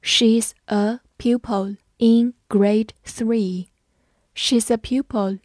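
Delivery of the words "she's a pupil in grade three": slow, careful read-along. Shown as a demonstration. Teacher reading slowly for students to repeat. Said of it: "我们慢慢来读".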